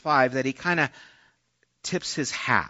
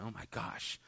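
stunned whisper; 5 semitones lower, 20 dB quieter than usual. Retold or imitated. Reacting to sacrifice command.

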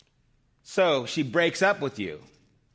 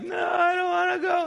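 imitating crying toddler; 3 dB louder than usual. Preacher imitating his grandson refusing to go, mock crying. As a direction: whine it like a screaming two-year-old. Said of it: "screaming, and he's crying, and he's like".

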